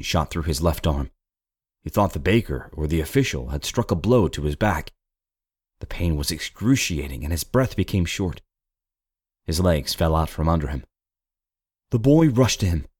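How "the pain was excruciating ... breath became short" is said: ordinary delivery, nothing marked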